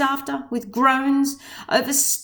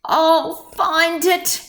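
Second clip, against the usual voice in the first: louder, slow resolute cry; determined cry; 5 semitones higher, 4 dB louder, much slower than usual. A narrator's reading. Horton vowing to find his friends, slow loud cry. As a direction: vow it out, drawn out. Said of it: "cried Horton".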